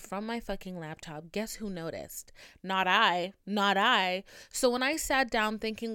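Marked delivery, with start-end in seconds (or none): 2.6-4.2 emphatic self-mocking declaration. Real, not acted. Admitting she missed the disconnect.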